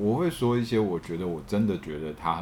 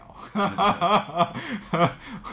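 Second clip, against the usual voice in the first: short laugh; 12 semitones higher, 4 dB louder than usual. Real, not acted. Laughs after saying boring.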